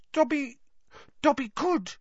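doubtful high squeak, halting; high elf voice, hesitating; Dobby. Acted. Elf considers speaking of old masters, doubtful squeak. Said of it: "he said doubtfully".

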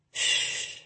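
very soft hushing; soothing. A narrator's reading. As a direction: gently shush him to calm him. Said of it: "I soothed".

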